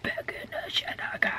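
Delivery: whispered echo. Pretend. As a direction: whisper it under your breath.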